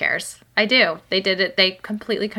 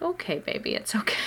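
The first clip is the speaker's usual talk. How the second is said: high, softer soothing mother; comforting; 8 semitones higher, 3 dB quieter than usual. Retold or imitated. Mother comforting her daughter who missed her, soft and high. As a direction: gently reassure a little child. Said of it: "I was like".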